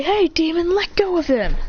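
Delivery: high-pitched urgent demand; calling out. Acted. Classmates defending their teacher, demanding.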